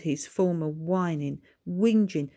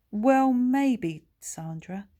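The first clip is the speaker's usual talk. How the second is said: ordinary delivery, nothing marked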